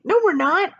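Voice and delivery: character voice. Acted. Characters arguing about sinking.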